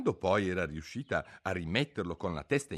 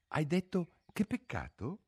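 higher rabbit voice; rabbit asking; 9 semitones higher than usual. A narrator's reading. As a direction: ask nervously as the rabbit. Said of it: "chiese il coniglio".

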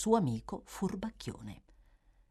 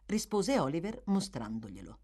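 plain reading voice

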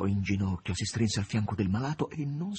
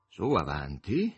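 encouraging Voland; 6 semitones lower than usual. A narrator's reading. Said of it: "l'incoraggiò Volant".

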